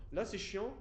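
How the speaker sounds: showing the annoying room sound; off-mic demo. Shown as a demonstration. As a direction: speak up from off the mic. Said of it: "si je parle comme ça, dans le micro".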